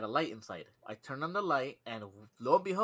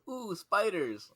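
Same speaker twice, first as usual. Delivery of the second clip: drawn-out, higher gleeful "Ooh, spiders!"; mock excited coworker; 7 semitones higher, slower than usual. Coworker mocked for loving spiders, delighted slow coo. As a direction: ham up a thrilled coworker, slowly. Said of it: "He goes".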